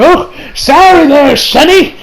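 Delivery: put-on old-timer calling out an apology; old guy. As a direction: play an apologetic old fellow. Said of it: "it's the old guy who's like".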